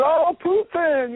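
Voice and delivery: high, slow, swooning over the name; retelling a Russian lady raving about Putin, high and slow; gushing older lady. Retold or imitated. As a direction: gush over him like a devoted fan. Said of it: "she started, like, raving over him".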